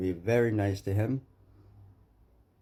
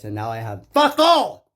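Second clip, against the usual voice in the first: the end of the line blasts out much louder; angry outburst; 3 semitones higher, 12 dB louder than usual. Real, not acted. A man yelling to viewers that he has nothing left, loud. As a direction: erupt on "fuck all". Bursting out about lost money.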